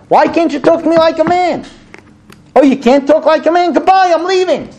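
acted frustrated man at his limit; super frustrated. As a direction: pitch climbing into an exasperated husband's complaint. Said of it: "He's getting super frustrated".

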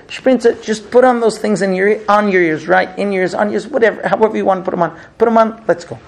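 fed up and loud, just wants to leave; losing his temper. Retold or imitated. Husband to wife running late, loud exasperated outburst. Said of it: "And he's already losing it".

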